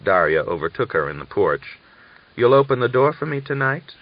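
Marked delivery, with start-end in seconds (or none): 2.4-3.9 higher, louder hopeful question; asking. A narrator's reading. Daria asks Natalia in the porch to let her in. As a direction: ask her a favour lightly. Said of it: "she asked".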